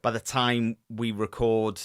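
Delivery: ordinary talk, nothing marked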